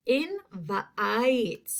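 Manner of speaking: "Invite" sounded out as in-vuh-ITE; long I sound. Shown as a demonstration. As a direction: split "Invite" and stretch the long I. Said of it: "That long I sound".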